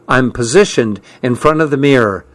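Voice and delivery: plain reading voice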